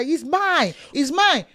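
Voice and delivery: lightly acted firm, high-pitched claim; possessive parent. Parent taking the tablet back from the children, firmly possessive.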